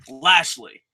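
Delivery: ordinary talk, nothing marked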